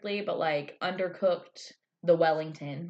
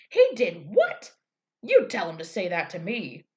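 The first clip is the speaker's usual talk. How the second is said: mock outraged dad; 5 dB louder than usual. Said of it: "this guy's like".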